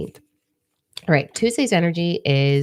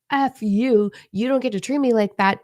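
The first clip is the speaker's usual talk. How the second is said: high, mock-furious comeback; confrontational snap; 7 semitones higher than usual. To a belittling boyfriend, a mock angry retort. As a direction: fire it back at a partner who talks down. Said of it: "We do not reclaim our power by being like".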